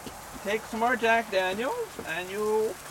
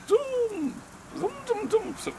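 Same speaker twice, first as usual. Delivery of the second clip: high, playful zoom zoom; zoom sound effect; 10 semitones higher, 3 dB quieter than usual. Pretend. Man filming the grill, making high playful zoom sounds. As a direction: sing out the zoom like a toy. Zooming camera on food.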